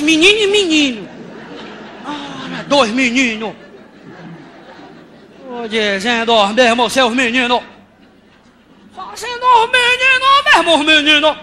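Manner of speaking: loud, slow, sing-song outrage; milk each word like a comic catchphrase; exasperated refrain. Acted. Venting to the audience about the boy, loud drawn-out exasperation.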